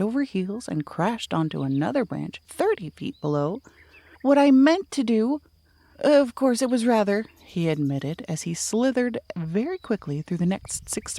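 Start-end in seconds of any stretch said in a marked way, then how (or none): 4.2-7.2 high, loud flustered explaining; a tumbling bear blurting excuses; flustered cry. Acted. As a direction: explain in a panic mid-fall. Pooh explaining while falling.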